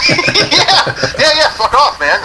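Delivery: high, laughing brush-off. Real, not acted. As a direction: laugh it off at him. Laughing dismissal.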